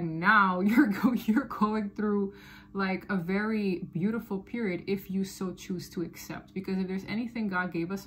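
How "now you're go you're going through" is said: slow words broken by a laugh; laughing through words. Real, not acted. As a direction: let the laugh spill over the words. Laughs mid-sentence while reading.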